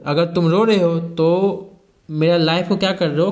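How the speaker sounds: ordinary talk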